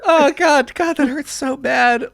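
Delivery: high, pained whimper; hammed-up tearful self-pity; mock crying. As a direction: whimper like a hurt kid alone in his room. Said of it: "He's crying like".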